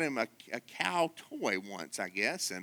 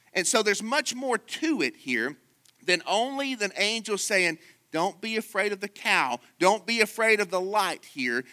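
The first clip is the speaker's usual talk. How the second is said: higher and a bit louder, urgent pulpit delivery; raised preaching voice; 7 semitones higher, 4 dB louder than usual. Acted. Preacher voicing the angel to the congregation, raised and urgent. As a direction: lift it as if announcing.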